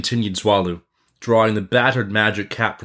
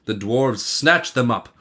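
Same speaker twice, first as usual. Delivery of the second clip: ordinary delivery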